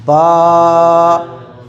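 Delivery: बा held long on a single breath; stretched out in one breath. Shown as a demonstration. Quran teacher demonstrating to students, long drawn-out chant. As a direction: stretch बा out until the breath runs out. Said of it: "पूरे एक सांस में एक लफ्ज़ पढ़ना है".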